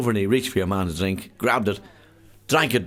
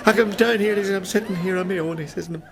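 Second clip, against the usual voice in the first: miserable drinker's lament; 10 semitones higher than usual. Acted. Sad man telling the truck driver his woes: high mournful lament. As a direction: moan every misfortune, barely holding back laughter.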